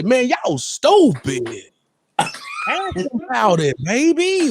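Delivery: slow, high mock voice; slow high teasing imitation of a buddy; imitating Easy. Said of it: "here, here, Easy".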